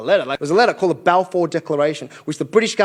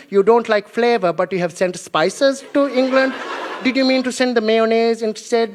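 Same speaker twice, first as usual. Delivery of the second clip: put-on polite, hesitant Indian-accented complaint; comic Indian accent, gently hinting. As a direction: play a hungry Indian host in a put-on accent. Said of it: "they're like".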